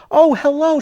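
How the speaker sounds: high-pitched imitation of a clear-voiced woman; imitated polite caller. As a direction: mimic a polite caller from the past, pitched well up. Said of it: "the most clearest voice".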